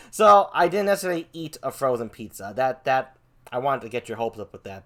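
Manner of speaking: normal talking voice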